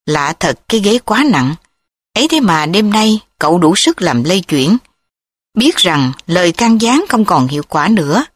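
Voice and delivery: plain reading voice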